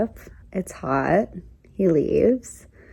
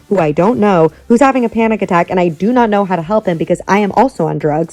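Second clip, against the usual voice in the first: retold panic; 8 dB louder, faster than usual. Recounts panicked realisation, being high too.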